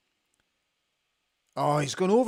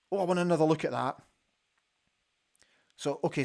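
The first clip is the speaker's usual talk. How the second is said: ordinary talk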